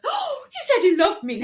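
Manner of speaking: high, loud swoony squeal through a laugh; mock gushing. Woman rewatching him say he loved her, mock gushing. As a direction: imitate an excited, love-struck gasp.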